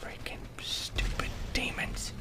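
annoyed under-breath whisper; whispered grumble. Real, not acted. Gamer whispering annoyance at the demons. Muttered after the scare.

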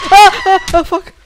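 high panicked laugh-cry into a curse; half laughing, half wailing in fear; scared horror game streamer. Real, not acted.

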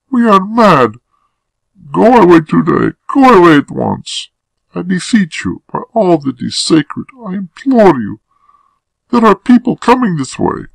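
very high, urgent woman's voice; horrified woman, imploring. Acted. Woman begging her lover to leave, panicked. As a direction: implore desperately, loud and high.

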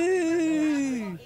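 slow high loud howl. Acted. Two drunk friends howling together in the car, loud howl. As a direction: howl it with abandon. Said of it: "we howled".

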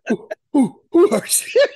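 high, loud mock-panicked asking; echoing the frantic Luffy. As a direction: repeat the line laughing at how desperate it is. S1 echoes the Luffy voice.

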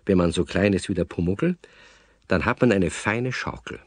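usual speaking voice

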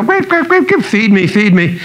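put-on very high chirpy baby bird begging for food; baby eaglet cheeping. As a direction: cheep it out like a hungry chick, high up. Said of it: "stay in their nest and go".

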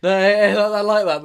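loud, high and excited. Real, not acted. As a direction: shout it out, thrilled. Excited approval.

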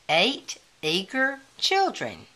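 plain reading voice

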